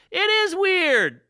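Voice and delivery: drawn-out dumb-blonde agreement, hammed up; ditzy blonde heckler. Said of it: "she'd be like".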